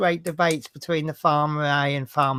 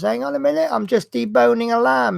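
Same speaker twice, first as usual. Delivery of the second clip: high, raised voice from out the back; calling back; 8 semitones higher, 5 dB louder than usual. Retold or imitated. Butcher calls back to the customer in a high, raised voice.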